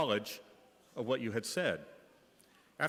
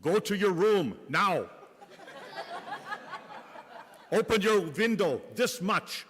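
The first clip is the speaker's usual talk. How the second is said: put-on higher, louder German accent of an old nun; firm German-accented instruction, higher and louder; Sister Scholastica's German accent; 8 semitones higher, 5 dB louder than usual. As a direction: play the old German nun, raised and slow on the last line in German accent. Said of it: "In her kind and gentle German accent".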